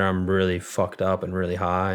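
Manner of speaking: usual speaking voice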